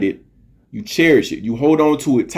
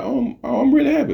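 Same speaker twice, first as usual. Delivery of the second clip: much higher, flustered claim of being happy; mock insisting girl; 13 semitones higher, faster than usual. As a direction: imitate her forced insistence lightly. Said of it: "She's like".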